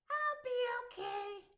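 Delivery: high, brave little goblin. Acted. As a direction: reassure him in a tiny high voice. Goblin reassures troll.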